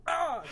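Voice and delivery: high yelp of a shot soldier; mock death cry. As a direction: cry out as if hit. Acting out soldier getting shot.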